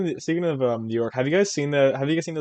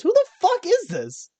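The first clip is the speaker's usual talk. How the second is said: much higher, slow, baffled "who the fuck is this"; baffled outburst; 4 dB louder than usual. Retold or imitated. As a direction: squeak it out in total disbelief. Same line repeated.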